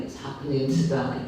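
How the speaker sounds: ordinary talk, nothing marked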